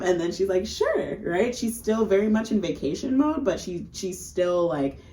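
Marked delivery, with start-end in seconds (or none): 0.7-1.2 sure, squeaked way up high; high eager sure. Retold or imitated. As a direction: chirp it way up, overly agreeable. Tourist accepting a drink.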